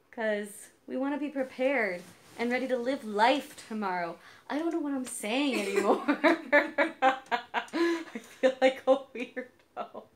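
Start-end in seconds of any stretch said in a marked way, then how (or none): 4.5-6.0 tipsy rambling. Real, not acted. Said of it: "I'm a little bit tipsy right now". 6.1-10.0 slow giggly words; laughing. Real, not acted. Giggling self-conscious.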